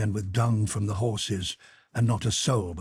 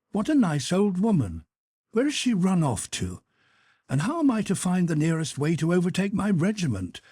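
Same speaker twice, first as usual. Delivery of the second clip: lightly voiced officer thinking aloud; musing young officer; 7 semitones higher than usual. A narrator's reading. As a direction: wonder to yourself what to do next.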